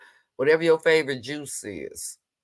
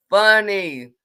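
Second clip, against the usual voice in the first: normal talking voice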